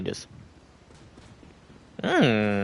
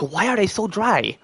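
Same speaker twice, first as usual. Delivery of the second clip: mock puzzled whine; 6 semitones higher than usual. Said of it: "I'm like".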